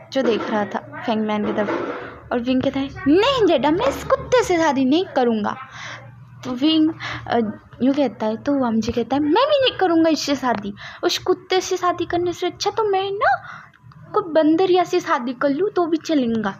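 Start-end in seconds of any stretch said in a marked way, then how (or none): 3.1-5.6 put-on refusing boy. A narrator's reading. Wing refuses marriage. 9.2-16.5 put-on refusing boy. A narrator's reading. Vam ji refuses marriage.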